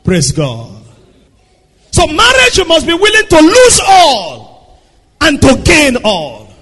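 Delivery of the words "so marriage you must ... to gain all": high, loud preaching cry that slows on the last words; full-force preaching. Acted. Climax of the marriage point.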